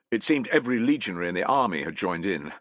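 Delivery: plain reading voice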